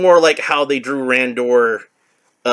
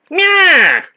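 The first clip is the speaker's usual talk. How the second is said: high villain meow; 7 dB louder than usual. Acted. Skeletor says meow.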